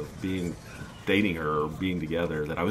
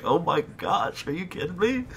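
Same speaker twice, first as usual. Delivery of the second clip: mock disbelief; 10 semitones higher than usual. Son reenacting his reply to mother, high mock disbelief. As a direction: imitate his own shocked reaction. Incredulous retold reaction.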